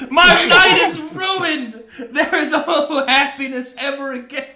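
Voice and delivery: slow loud hammed-up wail; mock despair. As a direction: wail it like a melodrama.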